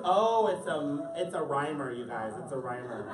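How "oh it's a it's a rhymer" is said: ordinary delivery, nothing marked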